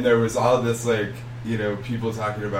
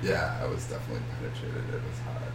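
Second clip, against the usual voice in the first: whispering; 4 semitones lower, 8 dB quieter, faster than usual. Real, not acted. Confessing sex quietly.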